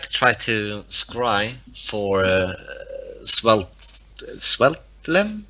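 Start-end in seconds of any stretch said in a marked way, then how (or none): none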